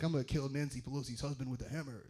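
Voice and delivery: mock menace. Mock radical's quote.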